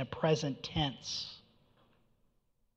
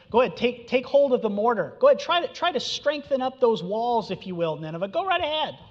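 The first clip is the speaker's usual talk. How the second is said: much higher, louder sarcastic goading; preacher voicing God's sarcastic dare to Nineveh; mocking dare; 7 semitones higher, 4 dB louder than usual. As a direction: egg them on, knowing they will fail. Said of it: "this mockery here".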